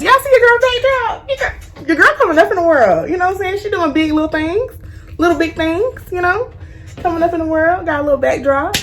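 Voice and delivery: high, bright hype voice; excited hype. Real, not acted. Hyping her friend's new backdrop.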